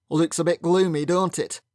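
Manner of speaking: a hint of a hearty Yorkshire accent; loud Yorkshire brogue. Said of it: "He spoke in a loud Yorkshire brogue".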